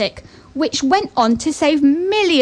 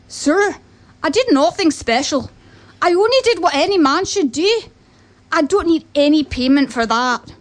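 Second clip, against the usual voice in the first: higher-pitched modest Scottish farmer; Scottish accent; 3 semitones higher than usual. Acted. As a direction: refuse humbly in a put-on Scottish accent. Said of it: "I won't do a Scottish accent".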